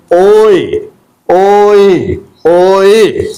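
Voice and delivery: oi as one gliding sound, said three times; drilled oi. Shown as a demonstration. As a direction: model the diphthong oi for the class. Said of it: "is pronounced as".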